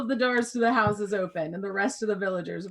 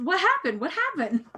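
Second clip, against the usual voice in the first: anxious questioning; 9 semitones higher, 4 dB louder than usual. Acted. Sandrine asks anxiously.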